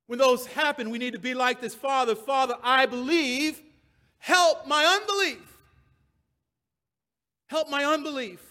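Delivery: loud, high-pitched plea from the pulpit; impassioned preaching. Acted. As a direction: cry it out like a desperate prayer. Preacher rising into the father's plea.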